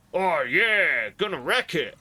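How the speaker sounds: a quick hammed-up Wreck-It Ralph shout; Wreck-It Ralph impression. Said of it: "Is that what Wreck-It Ralph says?".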